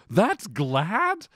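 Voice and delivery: high, incredulous question; mock letdown disbelief. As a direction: act out a disappointed listener's disbelief. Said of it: "there's gonna be temptation to go".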